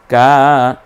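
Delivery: কা held one alif long and loud; stretched. Shown as a demonstration. Teacher demonstrates the long কা. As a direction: draw out the a of কা. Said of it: "এক আলিফ টেনে বলবো".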